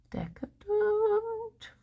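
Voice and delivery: high, soft, very drawn-out word; soft, slow mock-indulgent croon; exaggerated relish. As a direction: stretch it out high and slow, hamming up the luxury. Savouring the word again, exaggerated.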